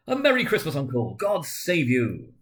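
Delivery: high, loud, bright greeting called out; cheerful cry. A narrator's reading. Nephew bursting in on his uncle, cheerful loud greeting. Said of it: "cried a cheerful voice".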